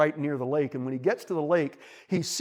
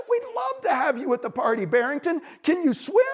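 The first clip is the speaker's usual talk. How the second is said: high-pitched beaver voice; welcoming beaver; 9 semitones higher than usual. A narrator's reading. Beaver invites bunny, then checks, welcoming. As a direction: welcome him, lifting into the question.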